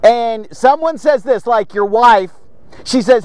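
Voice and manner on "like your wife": ordinary talk, nothing marked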